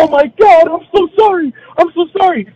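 high, loud flustered apology; apologetic wife. Retold or imitated. Wife apologizes for scratching his face, high and flustered. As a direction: gush out an apology, high and fast. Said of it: "And she's like".

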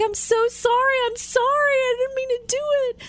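high, distressed apology; pleading like a crushed mother; apologetic mom. Retold or imitated. Mom apologizing to her son after the branch hit him, distraught. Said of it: "she was like".

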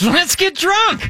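a friend cheerfully inviting you to drink; mock party invite. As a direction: squeal it like a giddy buddy. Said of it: "Hey, what are you doing this weekend?".

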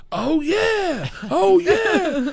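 high, drawn-out mock cheer; imitating real excitement. Comic imitating everyone at meeting Bert, hammed-up excitement. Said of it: "like, real excited".

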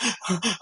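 a short high nervous laugh; awkward little laugh. Retold or imitated. Laughs retelling breakdown.

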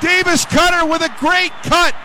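rising excited radio call building to a shout; play-by-play call. Acted.

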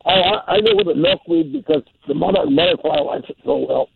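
ordinary delivery

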